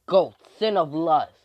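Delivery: loud summoning call; attack command. Acted. Fighter calling on a sin, loud commanding call. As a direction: send out the attack with force.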